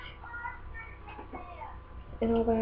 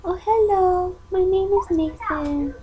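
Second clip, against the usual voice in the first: pretend baby voice; 7 semitones higher than usual. Mom speaking for her newborn son, in a high pretend baby voice.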